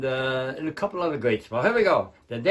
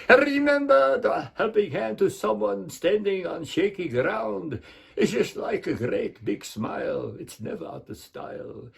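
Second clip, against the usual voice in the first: Bela Lugosi; 4 semitones higher than usual. Acted. Dracula urges helping someone in need, theatrical Hungarian accent. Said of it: "Bela Lugosi or Count Dracula".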